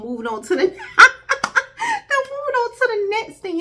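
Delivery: high, loud, laughing through the words; a real laugh breaking into the line; laughing disbelief. Real, not acted. Laughs at people walking off.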